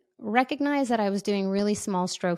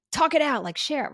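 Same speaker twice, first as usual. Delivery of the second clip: higher, eager encouragement; eager urging; 7 semitones higher than usual. Retold or imitated. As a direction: urge them to open up. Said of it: "I'm like".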